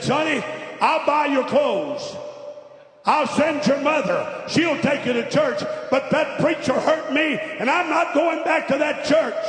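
high, loud, drawn-out outburst; preacher acting out a father's indignant refusal at full force; angry father. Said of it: "the man got angry at Sonny".

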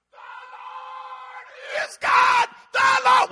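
slow, high wail swelling to a shout; hammed-up repentant people crying out; crowd's awed cry. Acts the people falling in worship.

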